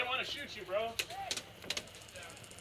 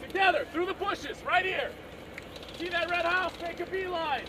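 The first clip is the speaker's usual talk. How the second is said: shouting orders; 6 semitones higher, 6 dB louder than usual. Real, not acted. Leading squad push under fire.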